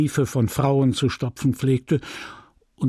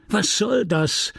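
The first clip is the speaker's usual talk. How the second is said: indignant outburst; 8 semitones higher, slower than usual. Acted. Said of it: "rief er gestikulierend".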